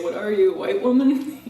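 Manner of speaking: imitated jokey jab, breaking into a laugh; joking teasing. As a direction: tease loudly, pitch climbing to the end. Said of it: "It's a big joke to say that".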